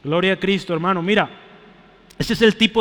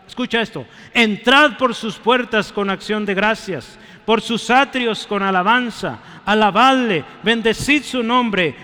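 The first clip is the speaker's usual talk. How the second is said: loud, higher, slow proclaiming of the psalm; fervent scripture reading; 3 semitones higher, 5 dB louder than usual. Acted. Pastor reading Psalm 100 to his congregation, fervent and loud. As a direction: read the psalm like a call to worship.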